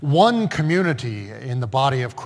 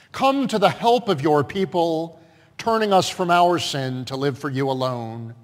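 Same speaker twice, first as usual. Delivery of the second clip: public prayer; 2 semitones higher, 5 dB louder than usual. Real, not acted. Pastor asking God's mercy for the people, solemn public prayer.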